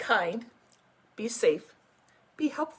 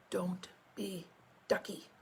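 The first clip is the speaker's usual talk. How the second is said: plain reading voice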